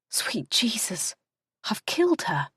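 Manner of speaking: hushed, shocked whisper; horrified whisper. Acted. Kat whispers in shock after throwing the fork. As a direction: breathe it out in horror. Her horrified first thought.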